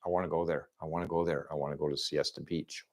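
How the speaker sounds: low, very soft murmur; hushed, repeated yearning; quiet longing. Retold or imitated. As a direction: say it under your breath, like a private wish. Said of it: "I was like".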